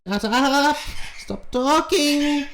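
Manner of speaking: high, loud, irritated monkey voice; aggravated monkey. Acted. Golden monkey snapping at the girl, high and irritated. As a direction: snap at the girl like an angry monkey. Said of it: "The monkey's getting aggravated".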